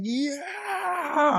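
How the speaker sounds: high, drawn-out distant-crowd holler, held back in volume; soft falsetto mock yell; imitated scream. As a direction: mimic an excited scream softly. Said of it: "I can hear Pastor Seth screaming".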